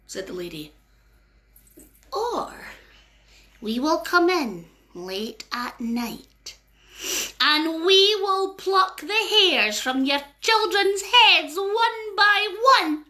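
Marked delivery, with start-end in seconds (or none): 2.1-13.1 slow, drawn-out threat, rising higher; slow spooky fairy warning; menacing fairy threat. Acted. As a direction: spell it out slowly like a spooky fairy.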